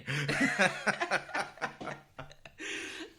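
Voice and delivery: long rising laughter; long high laugh. Real, not acted. Long laugh after own quote.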